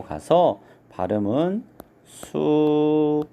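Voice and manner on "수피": "숲이" drawn out syllable by syllable as su-pi; pronunciation demonstration. Shown as a demonstration. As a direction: slowly say "숲이" as 수피, ㅍ carried over. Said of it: "이렇게 발음하는 것이 맞습니다".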